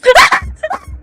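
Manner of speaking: shrill high nervous laugh. Real, not acted. Player laughing from fright, high nervous laugh. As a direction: laugh high out of sheer nerves.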